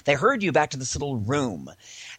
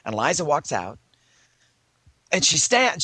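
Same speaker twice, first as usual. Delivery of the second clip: ordinary talk, nothing marked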